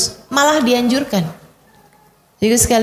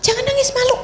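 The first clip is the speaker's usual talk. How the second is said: much higher, put-on scolding parent voice; mother hushing her crying daughter, higher and scolding; 11 semitones higher, faster than usual. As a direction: chide the girl not to cry, shaming her. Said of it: "Anak perempuan juga".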